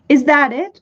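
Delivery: a touch of excited shouting; eager shout. A narrator's reading. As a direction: call out, running toward it. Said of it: "shouted Milo".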